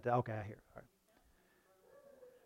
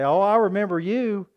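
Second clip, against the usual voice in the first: mocking classmate; 4 semitones higher, 6 dB louder than usual. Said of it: "and people say".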